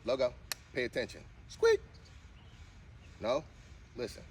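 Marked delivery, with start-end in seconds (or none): 1.5-1.8 very high squeaky "squeak", louder; squeaky high command. Shown as a demonstration. Owner coaxing her dog to squeak, very high and squeaky. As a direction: squeak the word like a dog toy. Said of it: "trying to get her to do a squeak".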